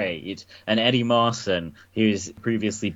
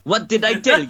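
put-on high, louder cod-Italian accent; mock Italian; 10 semitones higher, 5 dB louder, faster than usual. Podcaster echoing an actor's clip, mock Italian voice. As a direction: imitate a wildly off Italian accent. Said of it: "It's like an Italian vo".

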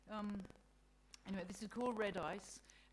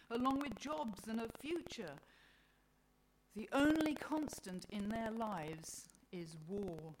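raised, emphatic appeal; pleading God, aghast; 5 semitones higher, 7 dB louder than usual. Acted. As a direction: plead the children's case as God. Voices God pleading for humanity.

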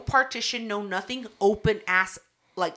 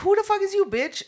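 mock yell; 11 semitones higher, 5 dB louder than usual. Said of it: "I was like".

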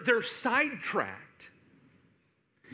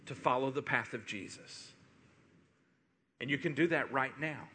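softer, lower invitation; soft altar call; 8 semitones lower, 7 dB quieter than usual. Real, not acted. Pastor inviting the congregation to follow Jesus, soft and lower. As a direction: gently invite them to choose.

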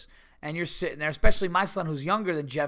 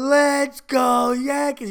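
long, high, loud stadium holler; drawn-out drunken cheer at full volume; drunk fan shout; 10 semitones higher, 9 dB louder, much slower than usual. Retold or imitated. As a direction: bellow it like a hammered ballpark fan. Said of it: "the guy was hammered".

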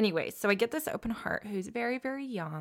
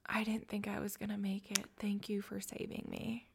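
hushed, breathy patient's thanks, whispered; patient thanking his nurse, in a choked whisper; tearful whisper; 2 semitones higher, 9 dB quieter than usual. Retold or imitated. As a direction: whisper it like a patient just off the tube, moved to tears. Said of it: "And he goes".

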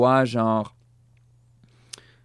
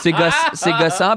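laughing it out, pitch up; real laugh breaking through the words; 7 semitones higher, 4 dB louder than usual. Real, not acted. Agreeing with the host through laughter.

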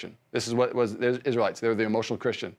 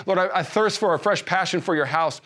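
earnest fervent prayer; 6 semitones higher than usual. Shown as a demonstration. Preacher praying aloud for the church, fervent and pleading. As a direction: pray it like you mean it, leaning into each thirst. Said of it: "if you need to pray it, pray it".

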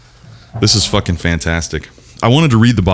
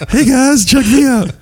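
excited; slower than usual. Acted. Showing off the football.

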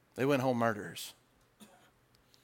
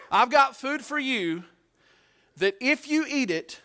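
emphatic; 9 semitones higher, 4 dB louder than usual. Acted. Jesus emphatic in retold scene.

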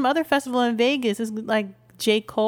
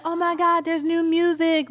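higher-pitched excited gush; excited fan reenactment, pitched up; all hyped; 8 semitones higher than usual. Retold or imitated. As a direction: act out getting hyped over a new release. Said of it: "I get all hyped".

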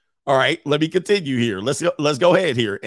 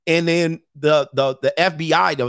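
ordinary talk, nothing marked

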